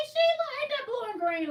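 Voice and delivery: high, loud delighted call to a viewer; excited call-out. Real, not acted. Showing off fabric to a viewer, high and excited. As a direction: gush over the fabric to Sheila.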